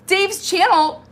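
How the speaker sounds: high, loud incredulous echo of the name; shocked, questioning repeat of "Dave's channel"; retold disbelief. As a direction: throw it back as if you can't believe it. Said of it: "I'm like".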